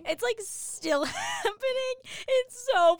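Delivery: laughing it out, high and loud; giggling through the words. Real, not acted. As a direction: crack up mid-sentence. Laughing at Brexit news.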